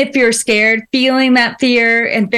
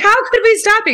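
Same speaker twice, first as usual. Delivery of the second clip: higher, mock incredulous mother; mom voice; 9 semitones higher, faster than usual. Mom answering her thanks, higher and amused. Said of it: "She's like".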